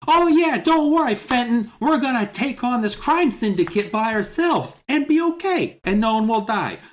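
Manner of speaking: put-on beam it out like a fearless kid sleuth; Hardy Boys hero. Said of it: "where it's just like".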